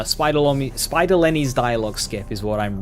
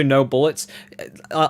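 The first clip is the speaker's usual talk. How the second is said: ordinary talk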